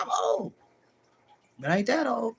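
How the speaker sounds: usual speaking voice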